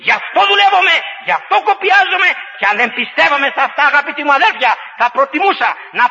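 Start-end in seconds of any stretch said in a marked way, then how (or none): 0.1-2.3 fervent declaration. Real, not acted.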